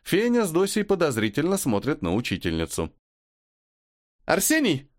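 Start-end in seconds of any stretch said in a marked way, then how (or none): 4.2-4.9 a bright, high joyful call. Acted. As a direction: call his name gladly from behind. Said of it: "знакомый радостный голос". (радостный оклик)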